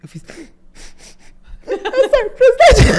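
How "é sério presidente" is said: very high, tearful squeal; choked-back mock crying. Militant answering the president's scolding, mock tearful squeal. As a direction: squeak it out through pretend sobs. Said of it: "Tentando engolir o choro a seco".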